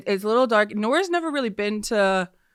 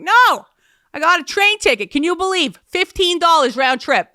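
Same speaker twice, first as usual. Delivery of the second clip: high, louder mock mom voice; mom quoted; 9 semitones higher, 6 dB louder than usual. Mom bragging to her daughter about a cheap ticket, high and loud. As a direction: squeak it out like an excited mom.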